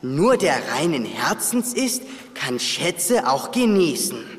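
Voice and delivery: langsam, feierlich vorgelesen; Rätsel vorlesen. Acted. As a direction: den Rätseltext bedächtig vortragen. Reads riddle aloud. (langsam und tief das Rätsel vorlesen)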